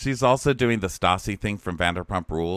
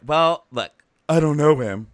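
louder, higher smoky-voiced impression; smoky, weird voice; 7 semitones higher, 8 dB louder than usual. Imitating a contestant sizing up the bachelor, smoky weird voice. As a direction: do a woman's odd smoky voice, punched up. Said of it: "she has a smoky, like, weird voice".

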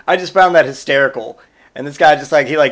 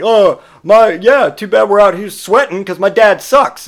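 put-on sulky kid complaint; 6 semitones higher, 4 dB louder than usual. Son sulking aloud.

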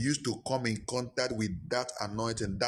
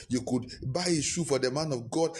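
normal talking voice

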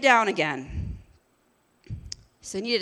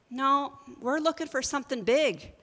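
ordinary delivery

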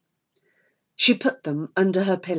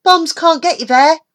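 put-on boy's voice; 10 semitones higher, 7 dB louder than usual. Dennis explains his habit.

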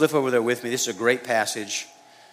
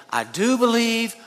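desperate plea; 10 semitones higher, 4 dB louder than usual. Retold or imitated. Desperate father answering Jesus.

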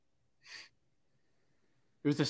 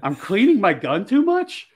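high, loud incredulous question; mock disbelief, pitched up with laughter around it; retold disbelief; 7 semitones higher, 6 dB louder than usual. Shooter questioning the armorer's advice, retold with loud incredulity.

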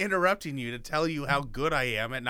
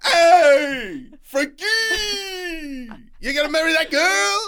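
much higher, louder Irish-Italian wise guy; over-the-top ethnic accent; 14 semitones higher, 11 dB louder than usual. Retold or imitated. Co-host mocking Howie's line in a loud Irish-Italian accent. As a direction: belt it like a brash Italian-Irish pal. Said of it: "such an ethnically inappropriate accent".

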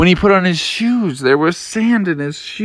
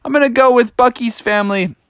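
higher-pitched, mild holler; calling out; 7 semitones higher than usual. Acted. Froggy telling his mom he's leaving, lightly hollering. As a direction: call out to Mom. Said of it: "he hollered out to his mom".

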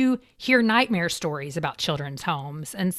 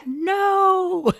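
long drawn-out, higher-pitched no; emphatic denial; 9 semitones higher than usual. Acted. Correcting an anxious believer, emphatic denial. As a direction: stretch the word out in protest. Rebutting the anxious prayer thought.

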